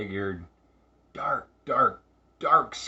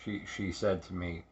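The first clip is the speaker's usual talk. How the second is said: usual speaking voice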